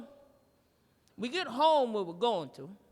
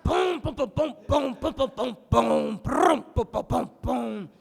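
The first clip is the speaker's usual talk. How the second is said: punchy popping booms with rolled bursts; rhythmic fart imitation; 2 semitones higher, 5 dB louder than usual. Comic mimics musical farts for the crowd, rhythmic fart booms. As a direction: drum out farts with your mouth.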